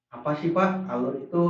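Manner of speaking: a light hint of a student raising a question; student asking. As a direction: play a kid asking the teacher. Teacher voices a student's question.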